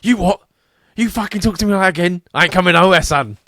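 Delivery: loud, high-pitched indignant Cockney; abrupt, no-nonsense. Acted. Alfred refusing Batman's call, abrupt loud Cockney outburst. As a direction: snap back and take no shit. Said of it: "really abrupt as well, and he wouldn't take any shit".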